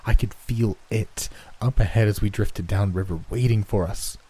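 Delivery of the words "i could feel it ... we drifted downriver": plain reading voice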